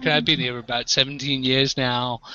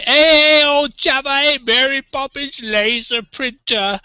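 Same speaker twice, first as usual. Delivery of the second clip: loud, high mock-English holler; high-pitched caricature English shout, slowing at the end; drunken mock-English accent; 10 semitones higher, 4 dB louder, slower than usual. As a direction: imitate drunk pals hammering up an English accent. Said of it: "they'd just be like".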